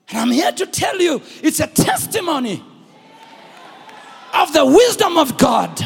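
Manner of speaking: loud, rising call to the congregation; preaching at full force. Acted. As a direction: lift the church to its feet.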